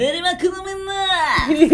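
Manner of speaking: mock impression. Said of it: "いや、ちゃう、全然ちゃうやん".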